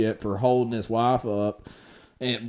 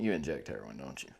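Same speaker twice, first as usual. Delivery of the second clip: much softer, mumbled under the breath; mumbled aside; 11 semitones lower, 17 dB quieter, faster than usual. Pretend. Friend teasing his buddy, mumbled much softer. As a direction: drop it to a murmur, "You inject heroin" half swallowed.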